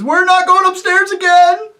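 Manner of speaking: high, loud, eager agreeing roar; the other voices roar their eager agreement; roaring chorus. Acted. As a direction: shout it like a crowd of cheering yokels. All the voices refuse.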